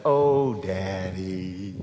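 a hint of a child begging for money; wheedling child.

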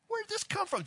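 high-pitched, incredulous retold question; mock bewilderment. Son and Janice react to the parents, mock bewilderment. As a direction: squeal it in baffled disbelief.

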